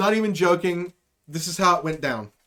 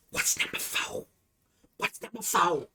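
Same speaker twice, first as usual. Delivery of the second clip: hushed, breathy whisper across the desk; weird whisper; 3 semitones lower, 9 dB quieter than usual. Retold or imitated. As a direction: sneak the question under your breath. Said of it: "I would do it in a weird voice".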